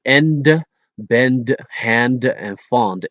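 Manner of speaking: end, bend, hand, fond with a sounded D; released final D. Shown as a demonstration. Teacher showing English endings, end, bend, hand, fond with released D. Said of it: "indicating that the word ends with a D".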